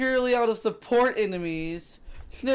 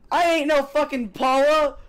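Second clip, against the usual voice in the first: indignant; 5 semitones higher, 5 dB louder than usual. Acted. Indignant loud outburst.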